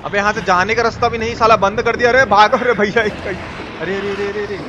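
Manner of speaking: normal talking voice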